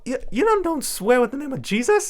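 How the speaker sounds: mimicking a startled math teacher; shocked teacher. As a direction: gasp it out, horrified at the swearing. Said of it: "And she was like".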